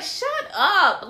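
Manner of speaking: high, loud, playful disbelief; hammed-up delighted brush-off; mock flattered protest. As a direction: squeal it like a flattered wife brushing off praise. Retells delighted reply to compliment.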